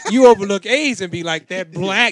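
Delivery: mock loud punchline. To his friend, retelling the Chappelle joke, loud and high. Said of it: "He said".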